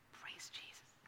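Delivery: breathy whispered praise; whispered aside. Real, not acted. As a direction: drop to a whisper for the joke. Aside joke.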